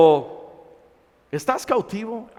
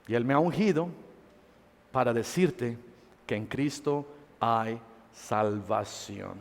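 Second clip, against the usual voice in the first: soft altar-call preaching; 10 semitones lower, 12 dB quieter than usual. Acted. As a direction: lower the voice and draw them into prayer. Soft intimate preaching into prayer.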